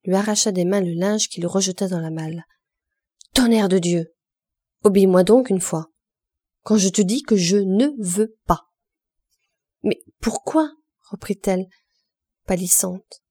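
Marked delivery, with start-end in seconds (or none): 3.3-8.6 loud furious curse and order; angry outburst. A narrator's reading. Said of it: "Il se fâcha". 9.8-10.7 much higher, anxious pourquoi rising; fearful question. A narrator's reading. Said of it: "pâlissante, effleurée d'un soupçon terrible".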